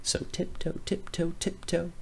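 whispered, creeping tiptoe; soft, creeping whisper; sneaky whisper. A narrator's reading. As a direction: hush like children sneaking. Children creep up.

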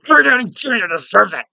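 high, loud hammed-up shouting; mock enraged yell. Yelling at whoever denies the actor's win, mock rage. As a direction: yell it like you're landing punches. Acts yelling while beating someone up.

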